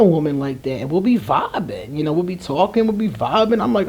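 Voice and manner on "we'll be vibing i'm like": ordinary talk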